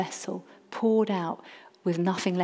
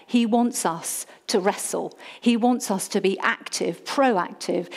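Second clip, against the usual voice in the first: ordinary talk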